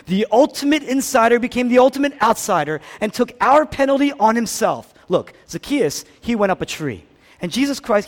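high, loud preaching climb; preaching at full force. Acted. Pastor to congregation, full-force preaching.

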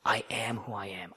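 hushed, awed whisper of the divine name; whispered holy quote; reverent whisper. Acted. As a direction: let it out as a sacred whisper. Quoting God, whispered.

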